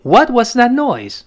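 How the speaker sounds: high, slow startled question. Acted. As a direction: sound jumpy at the noise. Acted reading of the line.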